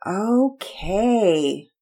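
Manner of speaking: put-on reluctant child giving up the argument; sighed. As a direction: give in with a long sigh. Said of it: "Penelope sighed".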